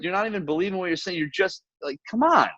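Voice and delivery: retold exasperated challenge, pitched high; quick, high protest jumping up at the end; calling him out. Calling out a buddy who doesn't believe his words, exasperated. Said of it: "I called him out on it".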